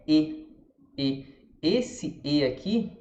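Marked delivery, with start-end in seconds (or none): 0.1-1.2 "E" as a spread closed EH; unrounded "E" with lips pulled sideways; Portuguese E, wrong here. Shown as a demonstration. Teacher showing what it is not, Portuguese E. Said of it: "porque não é".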